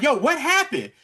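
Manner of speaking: high, loud imitation of an upset woman; agitated retold outcry. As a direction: demand an answer, voice jumping up. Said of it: "She's upset".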